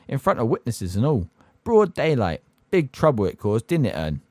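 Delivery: lightly voiced cockney bus conductor, gossiping; Stan's cockney voice. Stan voiced in audiobook.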